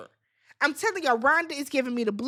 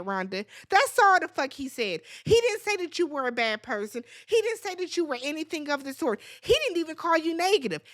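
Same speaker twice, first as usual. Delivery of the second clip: usual speaking voice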